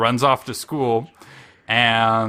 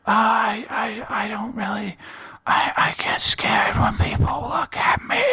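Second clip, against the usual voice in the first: put-on high, breathy-thin whispered shy girl voice, much higher; timid shy girl; 11 semitones higher than usual. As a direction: play a bashful girl shrinking from the camera, trailing into a whisper. Shy girl voiced in anime recap.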